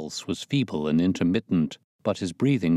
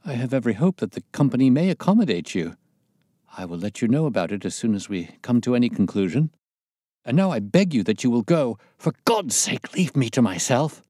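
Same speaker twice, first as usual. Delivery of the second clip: louder and fast, bursting out of control; words shot out; 5 semitones higher, 4 dB louder, faster than usual. A narrator's reading. Pinner, cracking under terror, drives the visitors out. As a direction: restraint snapping, fire it out quickly. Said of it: "These last words were shot out of him".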